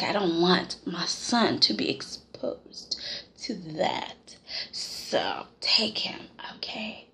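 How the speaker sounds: low, slow, fading almost to a whisper; hushed Bill imitation. Imitating a father handing his son over, hushed and low. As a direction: drop low and let it die away.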